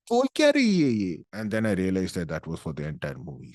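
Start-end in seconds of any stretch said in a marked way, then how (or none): 0.1-1.2 high, loud exasperated question; mock bewildered yell, pitched way up; baffled outcry. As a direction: cry out in comic incomprehension. His baffled reaction to mumbled dialogue.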